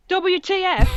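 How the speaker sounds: high, loud shouted exclamation. A narrator's reading. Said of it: "I shouted".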